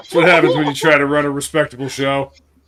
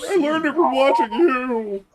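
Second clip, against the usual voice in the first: dramatic movie quote; 13 semitones higher than usual. Pretend. Quoting the famous ad line.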